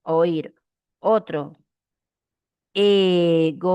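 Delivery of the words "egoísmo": "egoísmo" as e, go, ís, mo, each held; syllable by syllable. Shown as a demonstration. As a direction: sound out "egoísmo" in four drawn syllables. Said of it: "Separación y unión de vocales".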